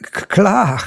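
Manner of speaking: flustered stammer on K. A narrator's reading. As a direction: trip over the first sound. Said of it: "stotterte ich".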